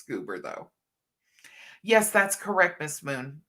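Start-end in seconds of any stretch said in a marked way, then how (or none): none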